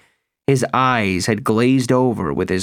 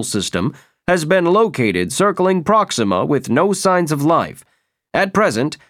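news bulletin; 6 semitones higher than usual. A narrator's reading. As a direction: read it like a TV newsreader. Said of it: "the television set clicked itself on and said".

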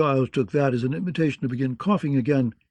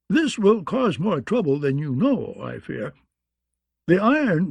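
lightly voiced quavering old maester; a hint of a trembling old man; 5 semitones higher than usual. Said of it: "Pycelle said in a quavering voice".

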